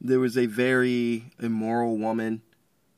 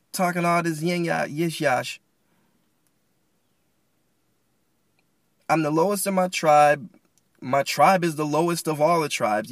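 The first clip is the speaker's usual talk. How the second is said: higher, louder mock indignant outburst; snapping back; 6 semitones higher, 6 dB louder than usual. Gideon talking back to the angel who blessed him, snapping. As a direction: fire back, acting fed up and affronted. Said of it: "He just kinda snapped".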